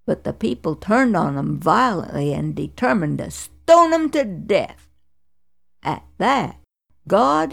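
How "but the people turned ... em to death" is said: ordinary delivery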